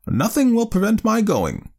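put-on high, determined old aunt; firm. A narrator's reading. Aunt refusing to be put off, firmly. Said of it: "she said firmly".

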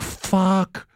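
high, drawn-out mock curse; retold outburst at the producers; mock fury. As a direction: spit it out in mock outrage. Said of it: "I'm like".

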